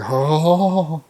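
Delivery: long, loud, high acted "oh"; anxious drawn-out oh. Not knowing the draft order, acted anxious groan. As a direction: let the suspense out in one breath. Retold suspense during the draft.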